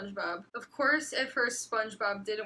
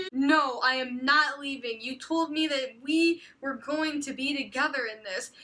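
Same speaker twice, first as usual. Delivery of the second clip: hammed-up tearful argument, higher and louder; melodramatic pleading; 4 semitones higher, 4 dB louder than usual. Reading a wife's fanfic plea, hammed-up and laughing through it. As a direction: overplay a wife begging her husband. Acted dramatic argument with husband.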